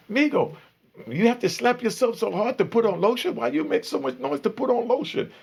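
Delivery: put-on high Spanish-accented complaint; broken English accent. The Spanish cellmate nagging about the lotion, mock Spanish accent. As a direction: needle him in a high, accented voice. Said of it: "the Spanish dude is sitting there telling".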